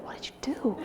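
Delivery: hushed, voiceless amazement; astonished whisper. Retold or imitated. Husband to wife after daughter calmed down, astonished whisper. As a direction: whisper it like a stunned onlooker.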